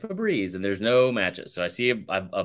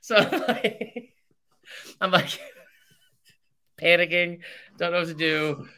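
high, laugh-choked telling; laughing while talking; 8 semitones higher than usual. Real, not acted. Storyteller cracking up over his clogged toilet panic, laughing while talking. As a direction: struggle to get it out through laughter.

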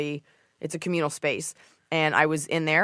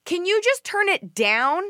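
much higher, sweet polite voice; polite high request; 12 semitones higher, slower than usual. Retold or imitated. Gym-goer asking trainer, high polite. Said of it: "And I go".

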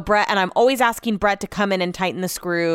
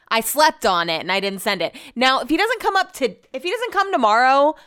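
normal talking voice

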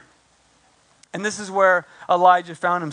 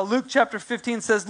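ordinary talk

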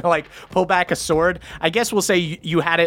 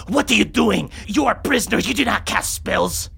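higher-pitched indignant guard voice; scolding young captor; 5 semitones higher than usual. Acted. Guard catching prisoner casting a spell, indignant scolding. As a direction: tell off a prisoner in a raised pitch.

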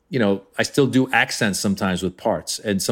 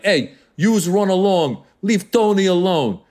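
Italian mob boss; 7 semitones higher than usual. Acted. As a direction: growl it like the neighbourhood boss. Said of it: "So now I'm doing the Italian guy".

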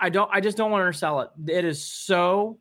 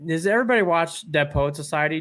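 ordinary talk, nothing marked

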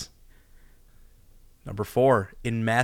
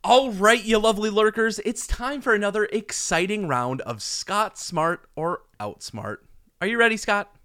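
put-on booming kids show opener; 4 semitones higher than usual. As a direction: pump up the audience like a host. Hosts game show segment.

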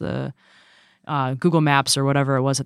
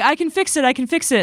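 frantic; 10 semitones higher than usual. Retold or imitated. Retold dream, panicked self-quote.

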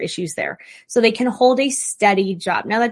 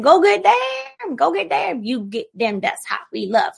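soaring high cheer on get, then settling back down; 6 semitones higher than usual. Retold or imitated. Partner hyping you up, a high cheer that fades. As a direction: cheer them on, then calm it down. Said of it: "Do they say, like".

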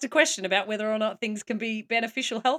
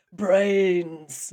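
zombie moan; 4 semitones lower, 4 dB louder than usual. Retold or imitated. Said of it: "They're like".

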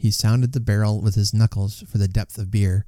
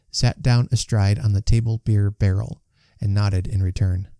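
ordinary delivery, nothing marked

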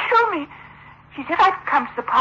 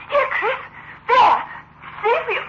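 higher, crying pleas; tearful urging; 11 semitones higher than usual. Acted. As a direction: push him to look, near tears. Frantic search for other woman.

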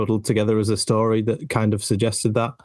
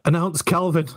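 eager fan demand; 8 semitones higher than usual. Retold or imitated. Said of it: "We're all like".

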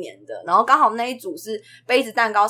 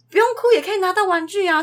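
praising; 10 semitones higher than usual. Retold or imitated. Teacher praising the child.

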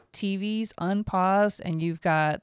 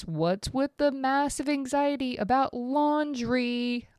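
ordinary talk, nothing marked

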